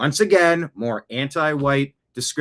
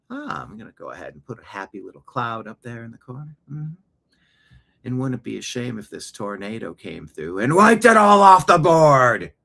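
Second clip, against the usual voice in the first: hushed gentle painter voice turning louder and menacing; mock soothing painter; 4 dB louder than usual. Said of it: "if you ever played Sim City".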